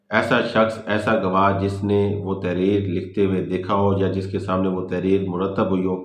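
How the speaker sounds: ordinary talk, nothing marked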